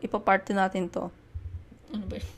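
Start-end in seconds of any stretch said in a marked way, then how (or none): 1.9-2.3 words broken by a burp; talking while burping. Real, not acted. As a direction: talk through a small burp. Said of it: "Dinidighay ako".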